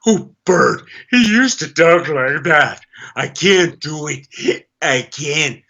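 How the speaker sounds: slow, clenched Kirk Douglas voice; Kirk Douglas impression. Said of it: "Kirk Douglas".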